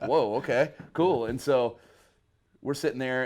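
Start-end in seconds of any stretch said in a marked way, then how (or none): 0.0-1.2 laughing, louder whoa; half-laughing awe; amused surprise. Retold or imitated. Young bassist amazed at the reunion, laughing. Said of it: "and I was like".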